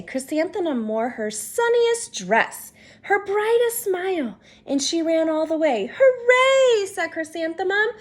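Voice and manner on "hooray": high, loud cheer of joy; excited cheer. Acted. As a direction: shout it with delight. Child runs excitedly to school.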